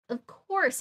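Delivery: exclaiming. A narrator's reading. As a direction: exclaim it as a sudden realization, mildly acted. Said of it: "I exclaimed".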